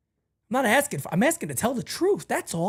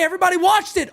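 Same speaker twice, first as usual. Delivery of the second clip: normal talking voice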